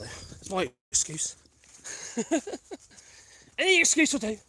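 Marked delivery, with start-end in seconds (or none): none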